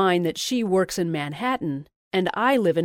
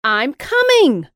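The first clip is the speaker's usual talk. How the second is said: loud, high, slow shout; excited shout; 7 semitones higher, 9 dB louder, slower than usual. A narrator's reading. Louise shouting her plan to Jessica, loud and high. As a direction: yell it with glee. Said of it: "she shouted".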